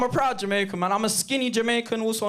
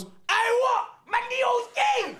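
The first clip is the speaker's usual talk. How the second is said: mate shouting; slower than usual. Retold or imitated. Mate at the back shouting his shock at McKellen's news. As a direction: yell it from the back of the hall. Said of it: "my mate at the back goes".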